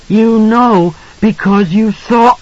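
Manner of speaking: put-on very high, slow child's cry; accusing boy. A narrator's reading. Boy confronts his father, high drawn-out accusation. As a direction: accuse him in a high, stretched-out voice.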